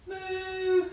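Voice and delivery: put-on high playful moo for the kids; cow moo. Shown as a demonstration. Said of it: "make the cow noise".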